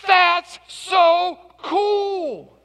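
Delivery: a starstruck shop clerk gushing over a suitcase; excited gush. Retold or imitated. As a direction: draw out That's so cool like he's starstruck. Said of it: "He is so excited".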